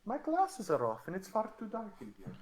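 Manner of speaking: put-on high, frail old farm lady voice; Muriel impression. Old woman calling to her dog in the dark, high frail voice. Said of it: "it's Muriel from Courage".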